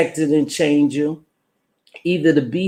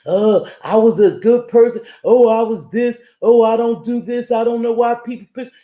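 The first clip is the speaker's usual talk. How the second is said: whiny, higher mocking complaint, sped up at the end; mock whining; 6 semitones higher than usual. Streamer mocking whiny YouTubers who blame others, in a mock whine. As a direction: imitate the crybabies with a mock whine. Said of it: "all that whining and crying".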